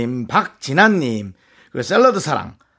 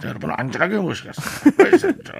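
mumbled, fast pilot PA; slurred captain announcement; 3 semitones lower, 5 dB quieter than usual. Retold or imitated. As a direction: garble it like a pilot on the PA. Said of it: "이렇게 하는 거예요".

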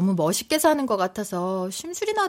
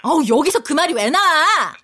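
louder, higher exasperated snap; indignant scolding; 7 semitones higher, 8 dB louder than usual. Acted. Wife scolds husband over his remark, loud indignant.

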